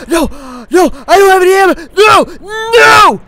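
loud, high cries of despair; panicked shouting. Real, not acted. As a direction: shout each word in panic. Out of ammo under attack.

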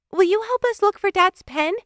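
high-pitched sister calling up; sister's voice. A narrator's reading. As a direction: ask it high, projecting upstairs. Sister calls up to brother.

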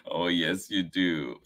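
plain reading voice

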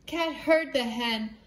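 plain reading voice

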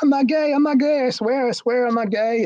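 high, loud imitation of desperate denial; mock-hysterical retold protest; frantic protest. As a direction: plead your case in a shrill panic. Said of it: "then he started crying".

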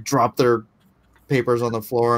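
ordinary talk, nothing marked

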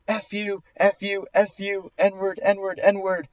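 high mock yell without the volume; retold yelling. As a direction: mimic repeated angry yelling, held back. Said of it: "she's still yelling at me".

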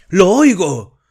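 high, loud ecstatic cry; a frenzied shout of revelation. A narrator's reading. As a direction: cry out in rapture. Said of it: "gritó".

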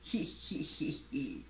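small, hushed hee-hee giggle; soft giggle. A narrator's reading. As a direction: titter softly, trailing down. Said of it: "He began to giggle".

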